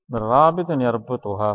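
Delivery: ordinary talk, nothing marked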